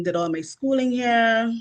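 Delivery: ordinary delivery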